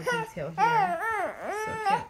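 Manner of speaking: high, loud squeal over tiny clothes; gushing squeal. Real, not acted. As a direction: squeal with delight at the little details.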